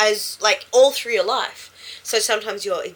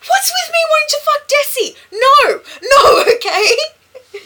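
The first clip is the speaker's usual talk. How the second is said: mock angry outburst; 9 dB louder, slower than usual. Imitating angry Random Git.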